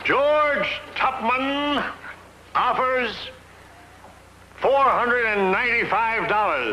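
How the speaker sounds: slow, drawn-out proclamation; grand announcement. Acted. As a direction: announce your own name grandly.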